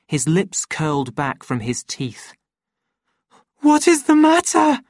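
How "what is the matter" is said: very high, loud panicked cry; near scream. A narrator's reading. Lenina to the silent man gripping her, almost screaming. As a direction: shriek the question in terror. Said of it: "she almost screamed".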